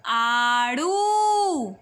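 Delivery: आड़ू with the ऊ held slowly; long ऊ in आड़ू; stretched vowel. Shown as a demonstration. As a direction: draw out आड़ू for children. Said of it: "बड़े ऊ की मात्रा".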